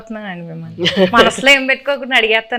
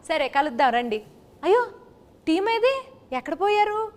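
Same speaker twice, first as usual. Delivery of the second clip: host in a put-on Srikakulam village accent; playful Srikakulam dialect, lightly done; Srikakulam slang; 2 semitones higher, 5 dB quieter than usual. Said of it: "కొంచెం Srikakulam slang బానే ఉందండి".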